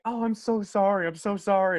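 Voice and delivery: high, drawn-out pitying apology; mock sympathy. Imitating sympathetic audience after the show.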